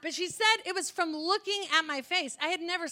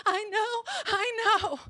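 crying; 7 semitones higher, slower than usual. Retold or imitated. Girl bawling in clinic.

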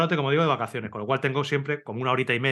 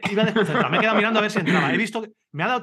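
higher and louder, laughing through the words; laughing at his own crash, higher, louder and faster; 8 semitones higher, 5 dB louder, faster than usual. Real, not acted. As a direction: laugh it out, faster on the second line. Laughing while retelling his crash.

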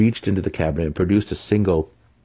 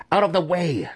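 sharp, much higher snap; snapping; 12 semitones higher than usual. A narrator's reading. Matron snapping at her daughters to get out of the way, sharp and high. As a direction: snap it out to clear the way. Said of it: "she snapped at her daughters".

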